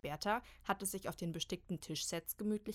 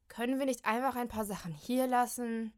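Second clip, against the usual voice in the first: groaning; 4 semitones higher, 6 dB louder than usual. Acted. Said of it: "stöhnt Kim".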